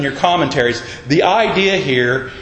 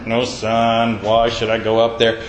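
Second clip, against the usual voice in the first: a much lower, sulky grumble of an unwilling son; glum churchgoer; 7 semitones lower than usual. Retold or imitated. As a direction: mope it low like a reluctant kid. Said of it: "Or were you glum?".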